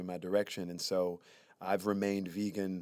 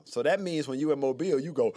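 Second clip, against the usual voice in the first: ordinary talk, nothing marked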